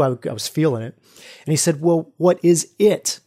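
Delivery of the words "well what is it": slow teasing question. Retold or imitated. Facilitator's playful question.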